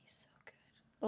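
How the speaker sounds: soft breathy whisper. Real, not acted. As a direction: whisper it fondly. Whispering about her pet.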